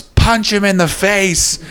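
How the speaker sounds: imitation of a trainer yelling; mock trainer's yell. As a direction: slowly call out a corner instruction, high-pitched. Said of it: "his trainer just yells".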